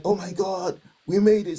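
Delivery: retelling his rush of joy; excited. Retold or imitated. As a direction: burst out with delight at seeing her. Running to wife, excited.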